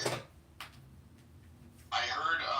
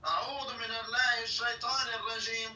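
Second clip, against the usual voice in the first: quick, higher mock recitation; imitating call to prayer; 7 semitones higher, faster than usual. Friend mimics the guy's call for prayer, light mock chant. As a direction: rattle it off like the prayer caller. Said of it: "this guy when he does the call for prayer".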